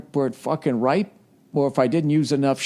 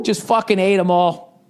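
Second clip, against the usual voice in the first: excited boast; 9 semitones higher than usual. Acted. Ric Flair binge boast.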